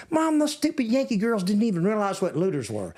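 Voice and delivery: put-on high, complaining Texan little-girl voice; little Texas accent. Little sister complaining to mom, high Texas accent. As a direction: imitate a kid sister griping in Texas accent. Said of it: "with a little Texas accent".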